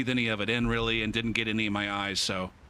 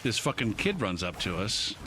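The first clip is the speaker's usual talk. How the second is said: ordinary talk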